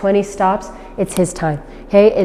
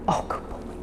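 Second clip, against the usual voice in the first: whispered praise; 16 dB quieter than usual. Real, not acted. Trainer whispering praise to the puppy.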